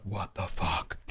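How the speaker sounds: low, soft disbelief; hushed alarm. Retold or imitated. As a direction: say it under your breath, listening for danger. Friends freeze hearing noise outside.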